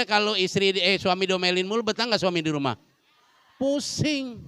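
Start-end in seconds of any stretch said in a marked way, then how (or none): 3.6-4.4 mock dizzy. Acting dizzy husband.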